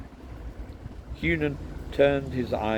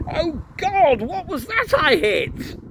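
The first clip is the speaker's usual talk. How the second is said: high, loud startled cry; shocked, high-pitched outburst; crying out in alarm; 8 dB louder, slower than usual. A narrator's reading. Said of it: "minds had cried out".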